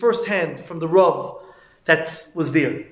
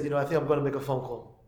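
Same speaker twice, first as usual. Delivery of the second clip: softer and quicker, lightly acted tearfulness; subdued, moved; 3 semitones lower, 7 dB quieter, faster than usual. Old man to rabbi after filling with tears, subdued and moved. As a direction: say it quietly, as if holding back tears. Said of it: "he filled up with tears".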